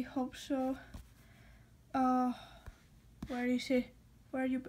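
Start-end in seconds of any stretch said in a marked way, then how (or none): none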